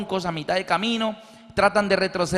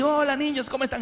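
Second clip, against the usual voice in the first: innocent act; 8 semitones higher, faster than usual. Retold or imitated. Padre disimula cambiando el canal.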